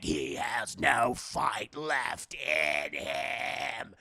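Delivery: very slow, low demonic voice. Retold or imitated. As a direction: drag out each word like a demon. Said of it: "the demon's like".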